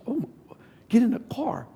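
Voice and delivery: urgent unvoiced whisper. Pastor whispering to the speaker to get going, urgent whisper. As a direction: hiss it urgently under your breath.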